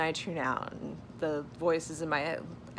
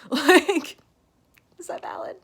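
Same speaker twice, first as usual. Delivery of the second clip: high, loud laughing "Like"; laughing burst; 12 semitones higher, 10 dB louder than usual. Real, not acted. Laughing burst after rant.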